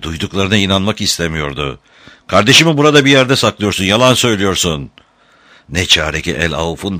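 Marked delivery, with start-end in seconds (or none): 2.3-4.9 high, loud, rapid outburst; angry accusation. A narrator's reading. Tom accuses his enemy of hiding his brother, angry accusation. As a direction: hurl the accusation, refusing to believe him.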